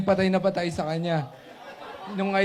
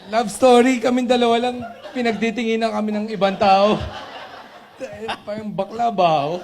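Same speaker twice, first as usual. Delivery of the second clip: laughing; 6 semitones higher, 5 dB louder than usual. Real, not acted. Laughing through college story.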